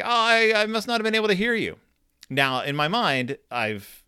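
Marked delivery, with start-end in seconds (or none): 0.0-1.8 high, quick fake excuse; feigned innocence. Son fakes not hearing his dad, high quick innocent excuse. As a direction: play dumb to get off the hook.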